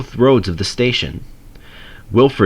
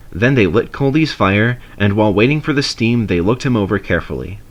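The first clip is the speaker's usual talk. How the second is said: plain reading voice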